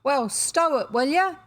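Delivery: loud, high warder's order; a warder shouting through the door; gruff warder. A narrator's reading. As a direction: tell him to pipe down, firmly. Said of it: "The voice said".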